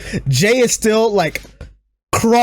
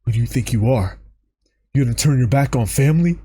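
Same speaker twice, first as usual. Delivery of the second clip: low stern Roman; 6 semitones lower, faster than usual. Retold or imitated. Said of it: "Roman just silently goes like".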